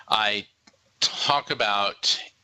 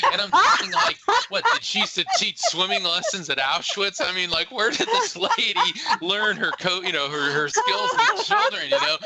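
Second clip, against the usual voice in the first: incredulous; 9 semitones higher than usual. Real, not acted. Laughing incredulous aside.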